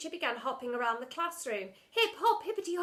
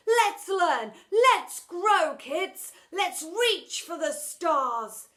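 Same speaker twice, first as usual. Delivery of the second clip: higher, louder eager teacher pep; rallying cry; 5 semitones higher, 10 dB louder than usual. Acted. Teacher inspiring his class, rising excitement. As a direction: pump them up to reach for the stars.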